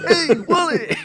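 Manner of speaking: mock teacher. Imitating the teacher.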